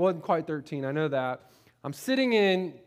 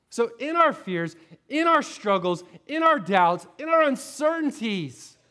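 louder and higher as the list climbs; building preaching; 6 semitones higher, 4 dB louder than usual. Real, not acted. Pastor rallying the congregation, rising preaching. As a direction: build each phrase toward the peak.

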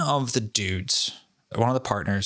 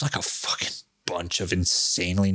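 breathy whisper; whispered aside; 3 semitones lower than usual. Real, not acted. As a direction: whisper it like a secret. Secretive aside.

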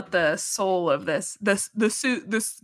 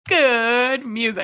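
slow drawn-out; 3 semitones higher, 3 dB louder, much slower than usual. Acted. Echoing the drawn-out chant.

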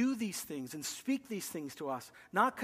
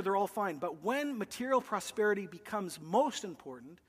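ordinary talk

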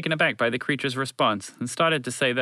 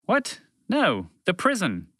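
very high "What?", then slow correction; high-pitched, incredulous alien denial; baffled; 10 semitones higher, slower than usual. Acted. Creature baffled by the misunderstanding.

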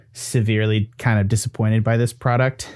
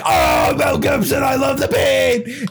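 mocking macho hero; 11 semitones higher, slower than usual. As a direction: parody the action hero relishing pain. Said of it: "it's like".

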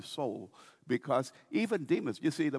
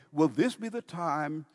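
normal talking voice

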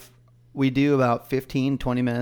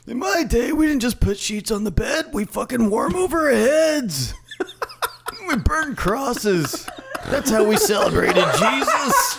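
put-on high, hammy old man voice; 10 semitones higher than usual. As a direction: play a bigoted old geezer, laughing through it. Continues the racist old man bit.

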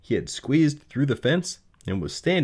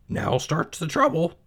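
high, worried piglet voice; high-pitched uneasy piglet; Wilbur the pig; 10 semitones higher than usual. Acted. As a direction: mutter like a young pig in trouble. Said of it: "thought Wilbur".

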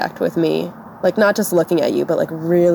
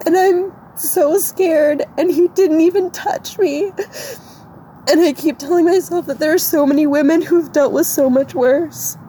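real high-pitched, tearful voice; crying; 10 semitones higher than usual. Real, not acted. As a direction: choke it out through sobs. Crying as she explains herself.

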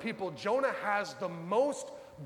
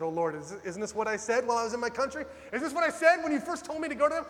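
exasperated complaint; 4 semitones higher, 4 dB louder, faster than usual. Retold or imitated. Jonah complaining to God.

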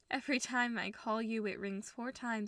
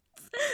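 tearful gasp; 6 dB louder than usual. Acted. Sudden gasp toward mom.

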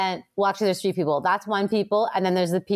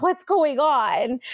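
high and very slow, puzzled at her own tears; baffled mock question; 7 semitones higher, much slower than usual. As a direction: ask it like you can't believe what's happening. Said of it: "I'm like".

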